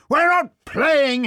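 loud, high, slow angry roar. A narrator's reading. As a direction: roar it impatiently, drawn out. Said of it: "he roared".

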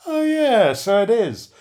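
high "Oh, yeah" of sudden realisation; caught-out surprise. Retold or imitated. Host realises the review is the guest's, surprised. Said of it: "took a closer look ... and went".

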